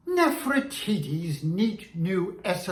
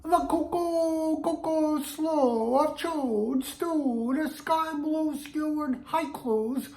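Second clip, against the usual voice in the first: pitched up high, bouncy; high sing-song voice; 6 semitones higher than usual. Shown as a demonstration. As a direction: lift the voice high and light. High voice for twister.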